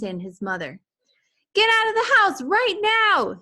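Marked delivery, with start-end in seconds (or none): 1.5-3.4 very high loud panicked holler; father yelling family out of the house. A narrator's reading. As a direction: yell it as you run. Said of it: "his father hollered as he ran".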